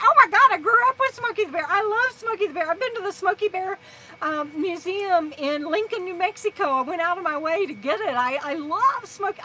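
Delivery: high, loud, fast gush of delight; excited gushing. Retold or imitated. Telling the young ranger she loves Smokey, excited.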